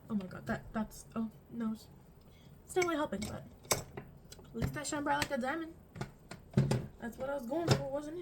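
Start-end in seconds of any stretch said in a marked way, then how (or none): none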